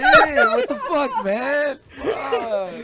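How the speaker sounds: hammed-up outrage through laughter; laughing mock yell. Friend pretending to scold Sid in front of everyone.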